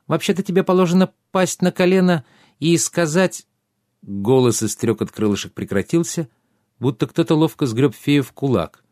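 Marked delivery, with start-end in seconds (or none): 0.1-3.5 high, indignant pixie voice. A narrator's reading. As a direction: scold like a tiny offended fairy. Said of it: "возмущённый голос феи".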